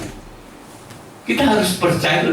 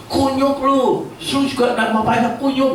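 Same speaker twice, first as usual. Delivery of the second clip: mock insult; 8 semitones higher than usual. Mocking insult as bad example.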